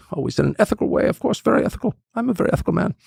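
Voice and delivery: lightly put-on German accent, mocking the word ethical. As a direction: tease his piety in a hint of German accent. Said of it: "in his accent".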